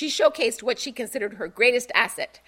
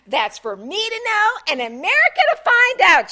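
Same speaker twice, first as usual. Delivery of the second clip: higher, louder teasing tease; coy and flirty; 11 semitones higher, 9 dB louder than usual. Acted. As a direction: tease the camera with a wink. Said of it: "with a hip roll and a wink".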